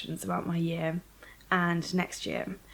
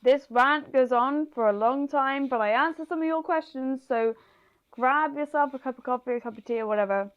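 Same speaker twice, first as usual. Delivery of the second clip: talking with a mouth full; words round a bite of food; 5 semitones higher, 4 dB louder than usual. Real, not acted. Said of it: "I'm talking with my mouth full".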